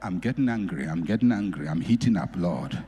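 a touch of a low, hushed plea to God; murmured prayer. Pretend. Preacher voicing an angry man's prayer, low hushed plea.

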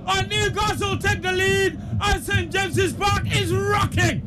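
ecstatic high-pitched shouted goal commentary; goal call. Real, not acted. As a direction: cry out the goal in soaring, sustained excitement. Commentator calls a goal live.

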